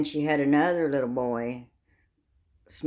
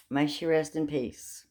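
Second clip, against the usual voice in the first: ordinary talk, nothing marked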